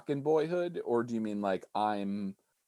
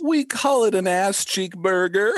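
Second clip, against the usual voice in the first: excited; 10 semitones higher, 7 dB louder than usual. Acted. Joke punchline, high and loud.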